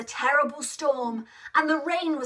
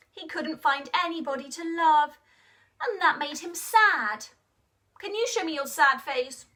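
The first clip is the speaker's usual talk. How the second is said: usual speaking voice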